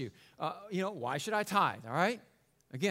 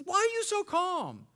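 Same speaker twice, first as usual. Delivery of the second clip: panicked; 12 semitones higher than usual. Acted. Panicked castaway asks calm friend.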